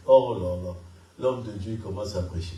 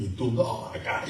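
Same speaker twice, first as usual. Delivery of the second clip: ordinary talk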